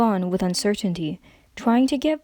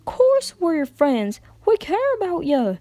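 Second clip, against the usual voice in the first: higher, louder pleading with a catch; strained, near tears; 9 semitones higher, 3 dB louder than usual. A narrator's reading. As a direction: plead through held-back tears. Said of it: "Applejack's voice cracked under the strain".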